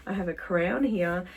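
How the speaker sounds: ordinary talk, nothing marked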